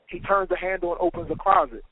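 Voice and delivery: ordinary delivery, nothing marked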